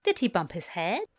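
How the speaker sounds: usual speaking voice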